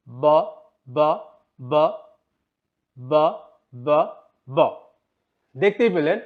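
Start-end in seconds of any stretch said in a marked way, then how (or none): 0.1-4.6 ব with lips together and hardly any air; B sound demo. Shown as a demonstration. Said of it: "বি এর উচ্চারণ যখন করছিলাম". (ঠোঁট মিলিয়ে কম বাতাসে ব উচ্চারণ)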